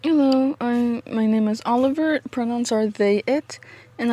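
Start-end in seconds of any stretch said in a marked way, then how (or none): none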